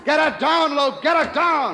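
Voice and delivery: high, loud desperate command; desperate shout. Acted. Captain shouting at Lou to dive the boat, desperate yell. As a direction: scream the order so he's heard. Sub rising toward destroyer.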